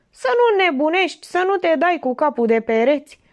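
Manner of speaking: high, pleading warning, stress on nu; worried husband pressing his wife; alarmed husband. A narrator's reading. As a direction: warn her not to go mad.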